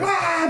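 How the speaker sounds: 2 a high, short mock scream of being grabbed; 3 yelp as the pterodactyl snatches you. 4 retelling Fry grabbed by a robot pterodactyl with a high mock yelp. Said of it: "he's like".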